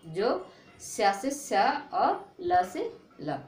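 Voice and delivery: जो, स्या, ल drawn out slowly; slow letter matching. Shown as a demonstration. Teacher matches mnemonic to letters, slowly. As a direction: map each sound slowly. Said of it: "ठीक है, जा".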